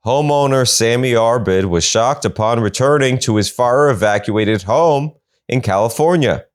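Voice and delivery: ordinary talk, nothing marked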